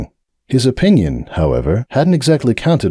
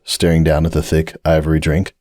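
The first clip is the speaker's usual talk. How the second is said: ordinary delivery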